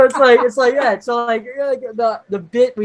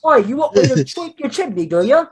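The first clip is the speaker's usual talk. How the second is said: put-on high, cheeky British accent; mock British accent; 8 semitones higher than usual. Brit offering to tweak a chimney, mock British accent. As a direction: needle him in a hammed-up British accent. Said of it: "the British probably felt when".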